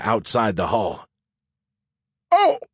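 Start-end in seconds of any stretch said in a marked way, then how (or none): 2.3-2.6 very high, held "oh" wail; alarm-like cry. Acted. Muffled phone calling underwater, alarm-like wail. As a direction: squeal it like a distant alarm. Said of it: "the underwater alarm said".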